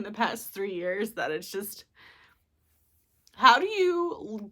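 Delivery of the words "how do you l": choked up. Real, not acted. Grieving breaks off mid-question.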